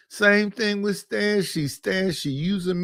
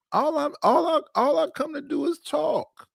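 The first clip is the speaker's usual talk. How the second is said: high, pleading whine, much higher; mock innocent whine; 10 semitones higher than usual. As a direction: play the wounded innocent in a squeaky voice. Whining that he only talks.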